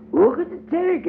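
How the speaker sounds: very high excited little voice; proud toddler. A narrator's reading. Tot shows off her mud ball, very high proud child voice. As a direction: show off a prize to everyone.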